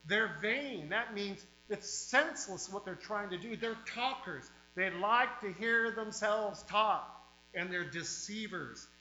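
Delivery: fiery pulpit preaching, hammering each line. Real, not acted. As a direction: revival preacher warning the flock. Preaching at full force.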